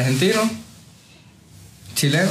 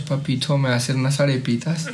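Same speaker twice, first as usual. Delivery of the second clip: soft, quieter paisa "Papito"; Medellín accent; 2 semitones lower, 3 dB quieter than usual. Retold or imitated. Imitating a paisa woman to his friend, softly. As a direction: sweet-talk like a Medellín woman. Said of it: "que hablan así".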